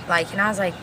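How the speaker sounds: ordinary talk, nothing marked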